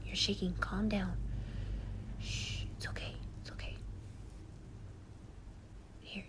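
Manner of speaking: hushed, tender soothing to a friend in tears; calm them down gently, mostly whispered; comforting whisper. Acted.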